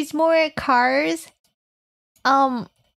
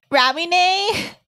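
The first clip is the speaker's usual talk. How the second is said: ordinary talk, nothing marked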